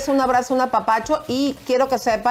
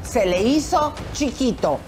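slow, raised dramatic reveal; announcer; 5 semitones higher, slower than usual. Acted. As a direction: announce it like a scandal. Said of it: "Señores y señoras".

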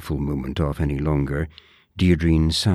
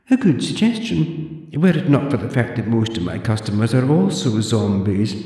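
put-on high, prim old librarian voice; haughty zombie librarian, clipped and proper; librarian Deirdre; 7 semitones higher, 3 dB louder than usual. Deirdre lectures the borrower in a high prim voice.

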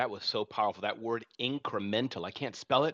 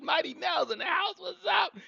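excited shout. Real, not acted. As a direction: shout out the new arrivals. Greeting arrivals in the chat.

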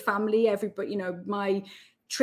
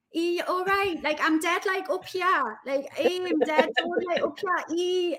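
squeaky, much higher Geordie voice; high-pitched Geordie younger self; 9 semitones higher than usual. Retold or imitated. Geordie mocking own old radio clip, high-pitched. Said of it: "My voice seems very high-pitched as well".

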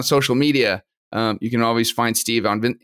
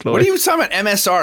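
high, loud, baffled question; surprised; 9 semitones higher, 4 dB louder, faster than usual. Real, not acted. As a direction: blurt it out in confusion. Confused by cohost's remark.

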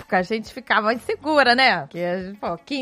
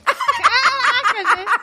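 laughing exclamation; 13 semitones higher, much slower than usual. Real, not acted. Reacting to friend's odd pet peeve.

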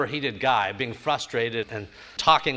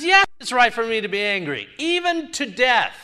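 higher, louder defiant insistence; Jonah defiant; 9 semitones higher, 5 dB louder than usual. Retold or imitated. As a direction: Jonah hits back hard, slowing on "even to death". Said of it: "He says".

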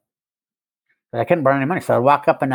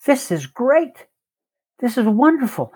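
high, slow, mock-delighted; sing-song mock delight, pitched up; gleeful inner voice; 11 semitones higher, slower than usual. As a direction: play the addict's mind cheering the bad news. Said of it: "You know what my mind said?".

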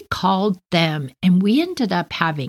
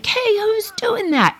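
much higher, puzzled call up into the trees; boys calling out. Retold or imitated. Boys in the trees call out, puzzled. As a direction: shout up into the branches, baffled.

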